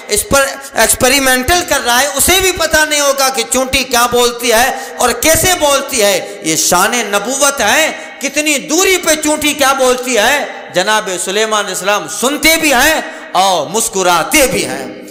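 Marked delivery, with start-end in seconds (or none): 0.1-10.4 preaching fervor. Real, not acted. Preacher rising to a climax.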